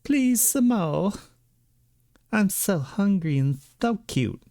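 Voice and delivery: voicing the begging cat. Owner voicing her cat begging for food, high and pleading. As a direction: beg like a hungry kitten, slow on the second plea.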